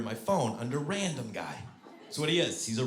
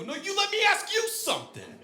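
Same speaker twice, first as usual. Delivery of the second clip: very high mock-angry retort; pissed off. Prankster turning Marie's words back on her, pissed off. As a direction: throw it back at a heightened, indignant pitch. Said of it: "And I got pissed".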